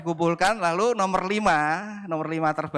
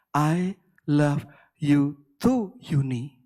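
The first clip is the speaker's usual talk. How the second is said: very slow, word by word, dropping lower; drawn-out punchline; 5 semitones lower, much slower than usual. Acted. Wife reading out her husband's reply, slow and deliberate. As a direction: space out each word to set up the reveal.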